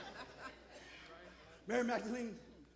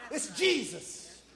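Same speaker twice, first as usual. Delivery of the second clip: ordinary talk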